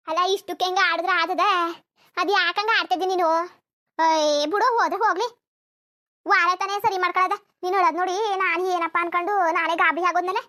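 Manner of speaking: loud exasperated scolding; loud, fed-up mother scolding her daughter. Acted. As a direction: tell her off for fussing over nothing.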